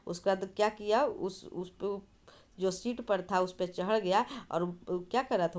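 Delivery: usual speaking voice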